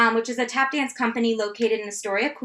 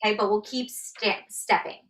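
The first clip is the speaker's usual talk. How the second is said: normal talking voice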